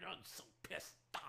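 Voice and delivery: very soft, low seething; anger drained down to near silence. Real, not acted. Fan stews as rivals pull ahead, softly seething. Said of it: "I'm so angry right now".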